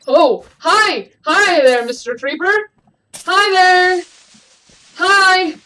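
high, loud, alarmed hellos; panicked greeting. Real, not acted. Gamer surprised by a creeper, panicked loud greeting. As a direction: yelp a greeting in fright.

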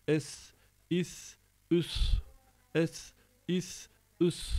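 "es, is, üs" with tongue between the teeth; lisping. Shown as a demonstration. Teacher demonstrating tongue placement, lisped "es, is, üs". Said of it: "Dilimizi iki dişimizin arasına alarak".

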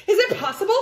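"Is it possible" high, loud and lifted as a question. Acted. Pigeon hearing a distant woof, "Is it possible" asked high. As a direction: ask "Is it possible" with amazed upward tune. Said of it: "Question mark".

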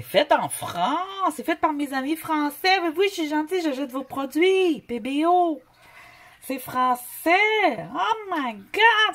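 high, drawn-out hammed-up gushing; gushing delight. Vlogger fawning over her French friends' products, playful high gushing. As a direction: gush in a very high, stretched voice.

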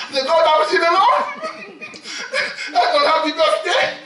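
high, fast and laughing; laughing mock lament. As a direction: blurt it out laughing.

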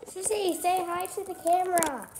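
loud coaxing call. Real, not acted. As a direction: call to her sister loudly. Calling to little sister.

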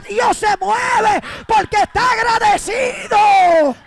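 loud, high, slow shouted preaching; full-force preaching. Acted. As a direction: whip the congregation into praise.

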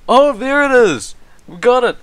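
loud, high triumphant cheer; excited. Real, not acted. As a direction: celebrate getting it right. Excited at nailing the word.

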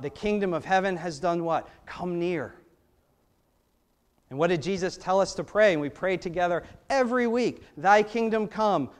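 normal talking voice

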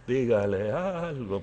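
slow, doubtful repeat to oneself; unprojected, dragging private thought; inner echo. Retold or imitated. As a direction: mull the request over, drawn out. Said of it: "pensé yo".

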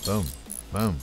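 usual speaking voice

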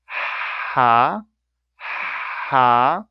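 "ha" with a breathy pharyngeal h, slowly; Arabic ha sound. Shown as a demonstration. Teacher pronouncing the first Arabic letter slowly for learners. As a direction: push "ha" from the throat, slow and airy. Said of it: "now I will start, uh, pronouncing this".

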